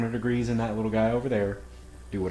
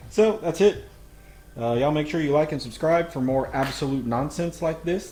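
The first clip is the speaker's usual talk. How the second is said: excited outro; 6 semitones higher, 8 dB louder than usual. Real, not acted.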